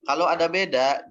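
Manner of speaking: usual speaking voice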